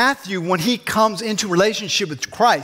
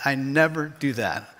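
mock denial; 5 semitones lower, 4 dB quieter than usual.

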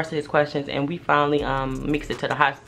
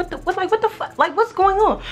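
very high, squeaked disbelief; mock bewilderment. Boyfriend watching from the bed, high-pitched bewildered sputter. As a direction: imitate a baffled onlooker way up high.

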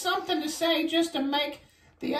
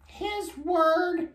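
slow storybook reading; much slower than usual. Acted. Child reading the book.

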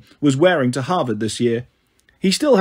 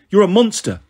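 raised, higher accusing voice; mild yell of outrage; 5 semitones higher, 4 dB louder than usual. A narrator's reading. Annabeth denounces Luke, mildly yelled. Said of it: "she yelled".

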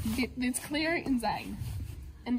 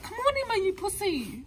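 taunting; 9 semitones higher than usual. Pretend. Girls taunting Emma.

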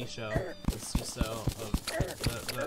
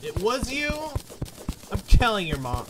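loud teasing shout, higher pitched; mock outrage; 7 semitones higher, 10 dB louder than usual. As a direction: yell it like a tattling kid. Catches friend, mock threat.

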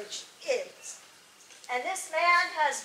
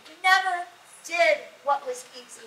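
usual speaking voice

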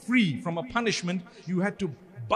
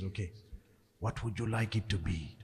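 sly hushed offer; 9 semitones lower, 8 dB quieter than usual. Retold or imitated. Accountant offering to fix numbers.

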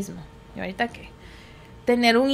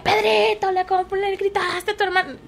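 higher-pitched mock scolding of the child; scolding parent; 8 semitones higher than usual. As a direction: accuse the kid. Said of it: "eso es lo que uno hace". (regaño de mamá imitado, más agudo)

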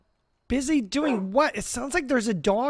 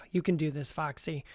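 much softer, to himself; quiet pep talk; 7 semitones lower, 10 dB quieter than usual. Acted. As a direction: murmur courage to yourself. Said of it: "self pep talk".

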